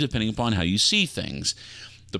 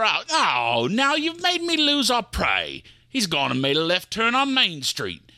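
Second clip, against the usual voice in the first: put-on higher, indignant pirate accent; 12 semitones higher than usual. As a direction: scold the mutinous passenger in a pirate accent.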